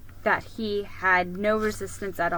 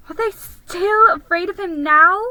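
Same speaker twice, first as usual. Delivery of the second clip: higher, louder crying question; sobbing; 9 semitones higher, 9 dB louder than usual. Acted. Susan sobbing about the cowards, high and crying. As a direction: ask it through sobs. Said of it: "sobbed Susan".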